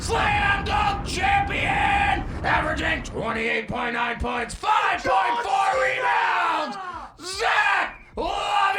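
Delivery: huge booming ring announcer, stretched; wrestling announcer. Acted. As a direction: blast every name to the arena. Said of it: "Now you work for the W W F".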